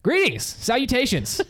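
high-pitched mock-grand greeting; hammed-up greeter. Worker showing friend how he greets customers, hammed-up greeting. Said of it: "greet 'em, like".

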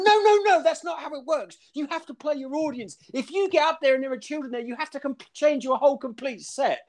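high, preachy mother lecturing; mocking a mum lecturing her boyfriend about comedy; preachy mum imitation. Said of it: "And she's going".

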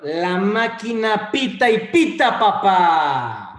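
slow, loud fan chant; hype shout. Acted. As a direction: belt it out like a stadium announcer. Hyping a fan greeting.

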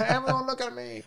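high, showy call for attention; playful high-pitched look-at-me; attention-seeking. Pretend. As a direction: squeal it like a show-off. Exhibitionist wanting cameras.

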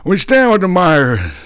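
high, put-on sanctimonious voice; high mock-pious voice sneering at opponents; mocking Democrats. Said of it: "they sit there and say".

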